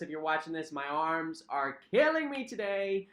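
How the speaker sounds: ordinary talk, nothing marked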